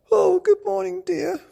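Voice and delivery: very high, loud cheery mother voice; Mummy Bear. Acted. Mummy Bear greeting Daddy Bear, high cheery voice. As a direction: sing it out brightly. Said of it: "she said".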